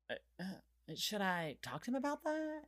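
hesitant, quiet airhead question; imitating clueless woman. Imitating Vanessa asking about her boyfriend, hesitant and soft. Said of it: "and she goes".

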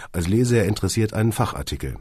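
ordinary delivery

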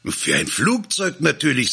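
high, emphatic reply; eager, pitch-jumping explanation; obvious answer. A narrator's reading. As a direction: answer as if it were self-evident.

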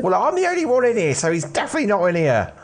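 put-on a jittery teen hiding in a warehouse; nervous teenage lad. Jimmy answers.

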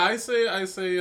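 loud, high, emphatic repeat; booming exclamation. Real, not acted. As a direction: belt it out like a showman. Excited booming repetition.

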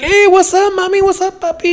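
high, sing-song flirting greeting. Retold or imitated.

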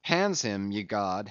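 ordinary delivery

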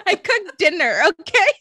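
giggling through the words; laughing. Real, not acted. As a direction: laugh while you say it. Laughing at own chores.

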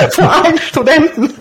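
said through real laughter; can't stop laughing at herself. Real, not acted. Laughing at her own blunder in front of a student, real laughter.

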